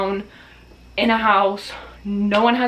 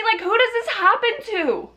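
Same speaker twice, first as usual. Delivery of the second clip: exasperated disbelief; 12 semitones higher than usual. Real, not acted. Exasperated at her bad luck.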